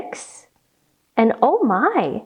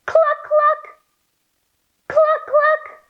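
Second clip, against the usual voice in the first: high, even double cluck; hen clucks; slower than usual. Shown as a demonstration. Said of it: "let's say cluck".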